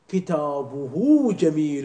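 كتابه stretched out as kitabuhu, the final hu loud; slow model. Shown as a demonstration. Teacher drawing out كتابه جميل for the class. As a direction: say كتابه very slowly with a clear u before the ha. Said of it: "مضمومة".

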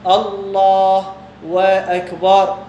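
very slow "الله وأكبر" with a drawn-out "و"; mock the mistake, stretching "وأكبر"; imitating wrong added wa. Teacher mimicking a person who says "الله وأكبر" by mistake, slowly. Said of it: "شخص يقول".

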